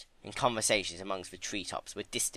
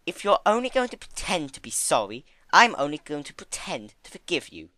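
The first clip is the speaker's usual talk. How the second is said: stern Fluttershy; 6 semitones higher, 5 dB louder than usual. Acted. Fluttershy angry rebuke.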